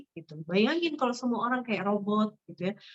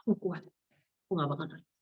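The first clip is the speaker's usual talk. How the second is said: mock tough front; 5 semitones lower, 6 dB quieter than usual. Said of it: "pasang diri perkasa".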